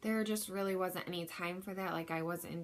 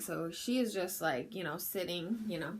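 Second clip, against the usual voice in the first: ordinary delivery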